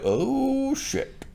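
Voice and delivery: high, startled panicked curse; high-pitched alarmed swearing. Retold or imitated. Voicing Maester's panicked reaction.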